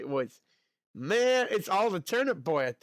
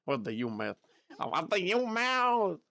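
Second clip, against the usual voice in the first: ordinary delivery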